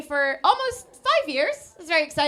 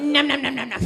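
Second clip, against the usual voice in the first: repeated nom-nom eating noises; imitated munching; 5 dB louder, faster than usual. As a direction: mime gobbling with rhythmic, louder noms.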